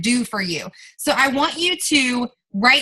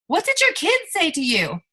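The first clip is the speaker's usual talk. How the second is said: put-on What did your kids say to you asked much higher, pleading; raised emotional repeat; 6 semitones higher than usual. Shown as a demonstration. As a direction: lift the whole question up, urging them to feel it. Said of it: "repeated question, second raised".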